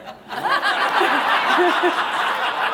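high laughing squeal; imitating the bear dance cry. Grandma imitating the grandson doing the bear, laughing. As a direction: squeal it playfully like a toddler. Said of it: "And he'd go".

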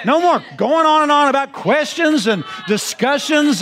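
high, shouted preaching; fiery preaching. Acted. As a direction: preach it to the congregation at full force. Preaching at full force.

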